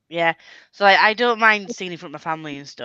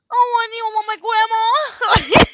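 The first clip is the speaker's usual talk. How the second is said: very high, loud, slow silly witch; hammed-up shrieky witch voice; weird witchy voice; 14 semitones higher, 8 dB louder, slower than usual. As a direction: squeal it like a cartoon witch. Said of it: "doing these really weird voices".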